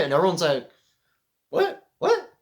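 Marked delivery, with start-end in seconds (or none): 1.5-2.3 very high squeaked "what?"; short, high-pitched mock disbelief; mock astonishment. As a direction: play a stunned crowd in falsetto. Acts out everyone's stunned reaction.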